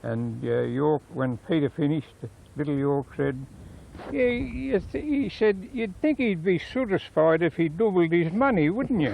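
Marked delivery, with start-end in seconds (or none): 4.1-9.1 high, lightly voiced old man; dry quip. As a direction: deliver the jab with a raised pitch. Little York's dry retort quoted.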